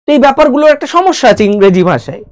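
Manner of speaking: ordinary talk, nothing marked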